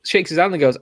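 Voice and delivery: normal talking voice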